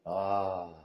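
ordinary talk, nothing marked

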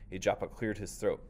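plain reading voice

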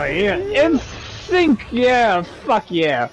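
high, loud cheering; triumphant cheer. Real, not acted. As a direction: cheer the win loudly. Celebrating synced kill.

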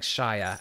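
"Shire" drawn out as "shy-er"; slow, full "Shire" rhyming with "fire"; American-style pronunciation. Shown as a demonstration. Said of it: "how Americans put, would pronounce it".

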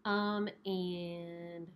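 muffled behind surgical mask; speaking masked; through a face mask. Real, not acted. Seen 5: Pink surgical mask covers her mouth and nose throughout; references unmasked.

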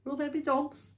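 high-pitched little fox voice; worried cub's high voice; frightened child. Acted. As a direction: ask like a scared small child. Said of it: "huge with fright".

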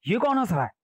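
high, alarmed voice; mock-frightened storybook query; startled question. A narrator's reading. Startled king hears the demon laugh.

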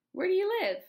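high, loud question out of the blue; sudden sharp question. A narrator's reading. Old lady breaks silence to question boy, sudden sharp question.

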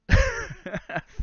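a sudden high squeal of laughter. Real, not acted. Host cracking up at a chat comment, high squealing laugh. Laughs after Boston accent talk.

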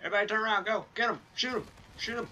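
frantic high-pitched yelling; panicking gamer. Real, not acted. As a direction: scream orders in a panic. Enemy behind, frantic orders.

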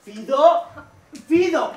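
high shouting for a dog; calling out. Acted. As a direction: call out across the park. Owner calls for lost dog.

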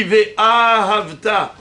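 "Ve'ahavta" with a long first "a"; "Ve'ahavta" slowly, as ve-AAA-havta; stress at the start. Shown as a demonstration. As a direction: stretch the "a" before "havta" in "Ve'ahavta". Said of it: "l'accent tonique au début du mot".

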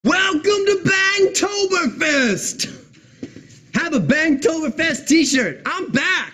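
high, slow announcer shout; shouted welcome. Acted. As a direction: greet the crowd at full volume.

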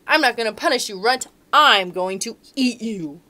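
a loud king lion in a bad mood; loud grumpy lion threatening the mouse. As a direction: growl it out as a cross lion king. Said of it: "said the lion".

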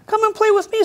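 high, bright pig invitation; a pig calling Duck over to play; cheerful pig voice. A narrator's reading. Pig inviting Duck into the mud, high-pitched. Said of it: "said Pig".

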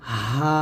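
"হা" thin, with a briefly held aa; low, breath-led "হা" drawn out one beat; lightly lengthened letter. Shown as a demonstration. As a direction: say "হা" lightly, stretching it a little. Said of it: "এক আলিফ টেনে পড়ব".

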